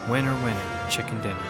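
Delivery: ordinary talk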